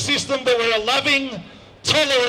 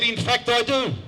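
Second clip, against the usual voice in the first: casual. Retold or imitated. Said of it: "a little bit casually".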